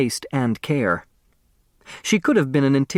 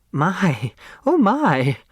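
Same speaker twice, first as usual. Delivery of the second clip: exclaiming; 7 semitones higher, slower than usual. A narrator's reading. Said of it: "Flora Bomback exclaimed".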